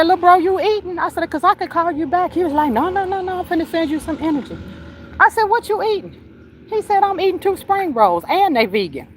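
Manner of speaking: telling it while fighting off laughter; high giggly retelling. Retold or imitated. Sister retelling the call, high and giggly.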